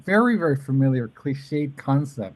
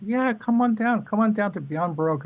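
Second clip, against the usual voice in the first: imitating Ellen; 10 semitones higher than usual.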